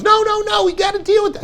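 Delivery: very high, mock-panicked insistence; nervous wife. Wife refusing to let husband sleep, mock-frantic insistence. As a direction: imitate her fretting in a squeaky high voice. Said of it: "all nervous".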